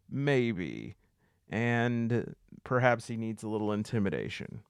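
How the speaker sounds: usual speaking voice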